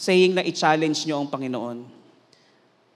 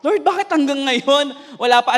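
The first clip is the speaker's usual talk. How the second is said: higher-pitched retold plea to God; raised, insistent asking why; pleading complaint; 6 semitones higher, 4 dB louder than usual. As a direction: question God in frustration. Retells his complaint to God.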